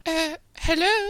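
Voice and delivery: very high, drawn-out falsetto; loud, squeaky pop-star caricature. Acted. Prank caller opening the call, high falsetto star impression. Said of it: "I'm gonna do [name]".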